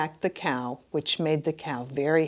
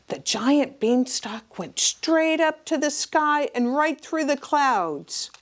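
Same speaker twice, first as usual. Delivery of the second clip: suspenseful whisper; 4 semitones higher than usual. A narrator's reading. Hushed suspense narration.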